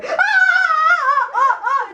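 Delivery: high, loud mock screams in bursts; shrieking in hammed-up fright; terrified scream. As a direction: imitate a startled kid screaming. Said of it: "he's, like, screaming".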